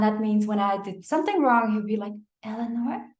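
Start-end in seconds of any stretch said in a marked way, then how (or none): 2.4-3.1 imitating a sweet trainer. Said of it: "extra nice and extra sweet".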